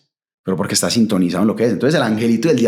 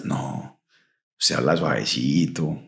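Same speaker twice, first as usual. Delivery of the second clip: ordinary talk, nothing marked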